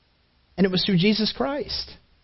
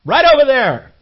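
loud, higher yelling at a bird; screaming at bird; 5 semitones higher, 4 dB louder than usual. Retold or imitated. Father yelling at the bird through the window, loud and pleading. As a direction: yell it across the yard, urging. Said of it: "scream at the bird and say".

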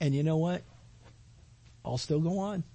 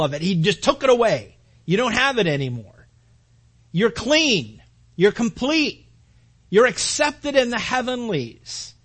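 loud, rising pulpit fervor; full-force preaching; 7 dB louder than usual. Acted. Pastor driving home grace to the church, fervent shout. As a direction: preach it hard to the congregation.

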